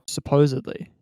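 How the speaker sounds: ordinary talk, nothing marked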